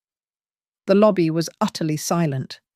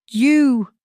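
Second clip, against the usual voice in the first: sudden loud, higher scream; screamed accusation; 7 semitones higher, 5 dB louder than usual. Acted. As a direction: hurl the word at him like an accusation. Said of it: "she screamed".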